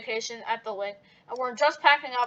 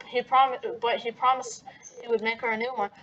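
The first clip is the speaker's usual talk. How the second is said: usual speaking voice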